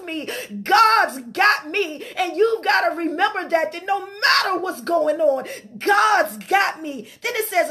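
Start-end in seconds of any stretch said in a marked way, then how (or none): none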